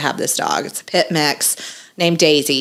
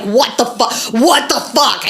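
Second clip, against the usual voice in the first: losing his mind; 5 semitones higher than usual. Retold or imitated. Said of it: "was gonna lose his mind".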